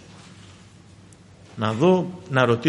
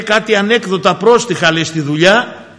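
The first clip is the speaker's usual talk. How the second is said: usual speaking voice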